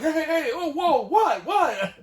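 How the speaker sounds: high, loud confused "hey, whoa, what"; startled waking. Retold or imitated. Husband jolted awake by wife, loud confused yelps. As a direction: jolt awake, bewildered and loud. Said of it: "I was like".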